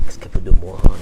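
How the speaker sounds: low, put-upon husband grumbling; mock exasperated husband; annoyed husband. Husband complaining about his wife.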